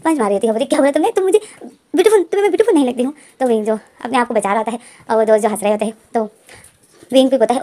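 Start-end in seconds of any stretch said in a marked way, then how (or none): 0.7-3.1 mock offended. Pam punches Wing, offended.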